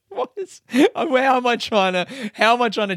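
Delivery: high-pitched, breaking into laughter; amused, giggly false start; laughing at himself. Real, not acted. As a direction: try to talk through a laugh. Cracks up at own argument.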